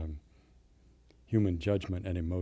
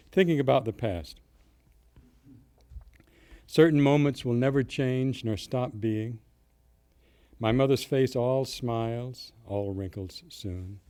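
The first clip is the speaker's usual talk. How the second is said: poem reading; 3 semitones higher, 4 dB louder, slower than usual. Acted. Poet reading his own poem about the past, measured recital. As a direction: read the verse in measured phrases with pauses.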